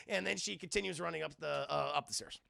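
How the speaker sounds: usual speaking voice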